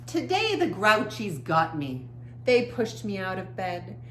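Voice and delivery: lively, expressive picture-book reading; reading to children. A narrator's reading. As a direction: read the story to young kids with feeling. Reads picture book aloud.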